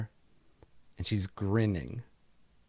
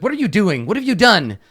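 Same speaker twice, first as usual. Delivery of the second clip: high, loud alarmed cry; alarmed shout; 12 semitones higher, 12 dB louder than usual. Acted. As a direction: shriek it at the intruder. Tabitha cries out in alarm.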